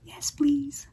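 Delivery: eager little whisper. Real, not acted. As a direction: breathe it like a secret treat. Greedy aside over chocolate.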